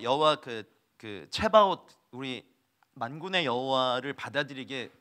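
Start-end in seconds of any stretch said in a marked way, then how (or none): none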